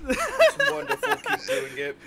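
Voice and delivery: high squeal of laughter; laughing. Real, not acted. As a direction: laugh through the words. Laughing praise of the voice.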